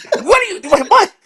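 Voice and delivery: high, stammering mock outrage; Tim Burton. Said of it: "he's getting all pissed off".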